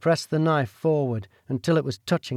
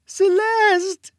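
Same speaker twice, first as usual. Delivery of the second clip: a very high, pleading call; desperate question; 4 dB louder than usual. Acted. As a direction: call out to her sister for help. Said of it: "she asked again".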